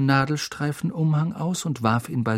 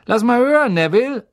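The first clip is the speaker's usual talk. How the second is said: high, loud character voice; Schaffner Stan; 9 semitones higher, 6 dB louder than usual. A narrator's reading. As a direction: call it out loudly, pitched well up. Schaffner Stan spricht Harry an. (hohe, laute Figurenstimme)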